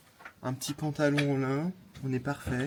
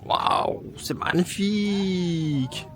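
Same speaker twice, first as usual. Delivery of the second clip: awed; 3 semitones higher, 6 dB louder, much slower than usual. Real, not acted. Admiring the mountain view.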